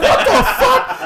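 high, loud hammed-up outcry; acted shock. Storyteller reacting to bunkmate's answer, shocked. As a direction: replay it as horrified disbelief.

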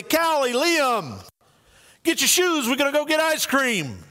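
father calling kids for ice cream, lightly voiced. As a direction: call the kids to get their shoes. Dad calling his kids.

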